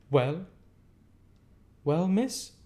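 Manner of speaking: mocking challenge; taunting. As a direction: tease him to his face. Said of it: "said in her taunting manner".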